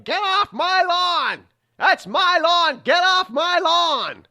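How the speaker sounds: put-on high, loud, slow old-man shout off the porch; hammed-up cranky geezer bellowing at trespassers; grumpy old man yelling. Cranky old neighbor chasing kids off his lawn, high loud mock shout.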